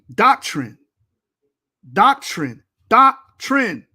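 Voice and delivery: "Doctrine." said slowly and loudly as DOC-trin; firm, loud correction; correct pronunciation. Shown as a demonstration. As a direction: spell "Doctrine." out loud as a lesson. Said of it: "Not doctrine".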